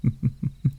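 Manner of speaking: soft, breath-only snicker; soft chuckle. Acted. Demon amused by bold hunters, soft chuckle. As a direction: chuckle softly under your breath.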